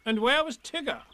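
high, fussy rabbit; bossy rabbit asking. A narrator's reading. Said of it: "asked Rabbit".

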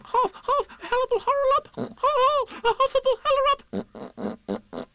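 very high panicked crying out; Piglet. Acted. Piglet scampering away, crying out in high panic. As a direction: squeal it, words tumbling into muddle. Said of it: "still crying out".

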